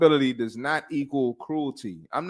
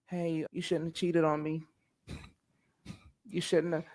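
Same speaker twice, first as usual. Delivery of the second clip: softer, higher timid coddling plea; mock coddling; 4 semitones higher, 5 dB quieter than usual. Mocking a soft reply to a cheating partner, coddling. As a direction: plead gently, as if afraid to offend. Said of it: "You gonna respond coddling".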